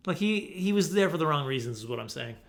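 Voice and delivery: normal talking voice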